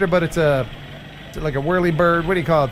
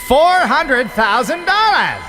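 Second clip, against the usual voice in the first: slow, weighty, raised price announcement; dramatic price reveal; 8 semitones higher, 5 dB louder, slower than usual. Acted. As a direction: drag out the price for effect.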